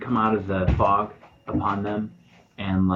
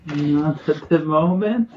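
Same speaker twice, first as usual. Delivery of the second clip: put-on higher, slow old-lady answer; old village lady; 6 semitones higher, slower than usual. Old lady asked if she has beets, slow higher voice. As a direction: draw it out in a raised old-lady voice. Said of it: "The lady says".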